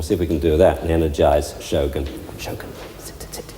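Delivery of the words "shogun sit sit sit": breathy hushed "sit" to the dog; whispered command. Real, not acted. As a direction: coax the dog under your breath.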